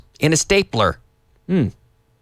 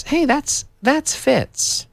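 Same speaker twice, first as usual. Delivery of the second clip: a high, surprised call across the room; surprised spotting a friend; 11 semitones higher, much slower than usual. Shown as a demonstration. Teacher acting out spotting Fitz, surprised. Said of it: "So I could say".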